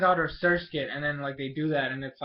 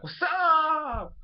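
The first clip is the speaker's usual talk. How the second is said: high, drawn-out imitation of a greeting; excited mock greeting; 13 semitones higher, 4 dB louder than usual. Alexa showing up to greet the group, hammed-up excitement. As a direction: sing out a showy hello, way up high.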